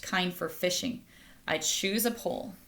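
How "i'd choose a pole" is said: ordinary delivery, nothing marked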